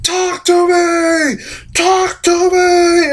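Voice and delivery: high, slow, stretched mock yell; hammed-up pleading. Documentarian begging Michael Myers to speak, hammed-up mock yell. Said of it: "And he's like".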